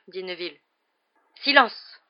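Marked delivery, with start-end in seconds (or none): 1.4-2.0 loud, high, abrupt order; sudden hoarse command. A narrator's reading. Lupin silencing the compartment, suddenly commanding. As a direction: cut everyone off sharply. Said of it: "lança soudain une voix rauque".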